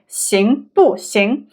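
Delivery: XING BU XING word by word, full tones; "Xing bu xing" drawn out with separate syllables; very slow. Shown as a demonstration. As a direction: say "Xing bu xing" slowly, BU falling clearly. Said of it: "if we say it very slowly".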